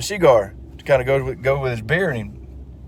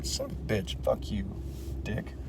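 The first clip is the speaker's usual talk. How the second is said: softer grumble under the breath; muttered cursing; 5 semitones lower, 16 dB quieter than usual. Acted. Boy swearing at grandpa under his breath, muttered. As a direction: curse quietly to yourself. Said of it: "Johnny's fucking".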